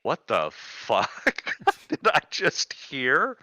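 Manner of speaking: stunned disbelief. Retold or imitated. Reacting to a bizarre insult.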